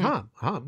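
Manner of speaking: imitated British vowel in "ham"; British pronunciation. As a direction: say "ham" with an open "ah", like "hahm". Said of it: "it's British".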